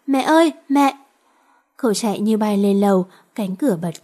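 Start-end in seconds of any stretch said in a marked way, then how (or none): 0.1-1.0 calling out. A narrator's reading. Boy runs to dying mother.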